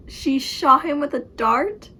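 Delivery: loud, slow, astonished question; amazed disbelief. Real, not acted. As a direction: draw it out in amazement. Amazed reaction to the book.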